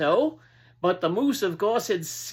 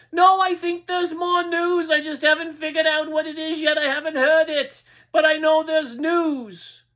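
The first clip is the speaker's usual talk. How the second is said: stubborn town crier; 8 semitones higher, 5 dB louder than usual. Acted. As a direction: insist there is news. Crier insists there's news.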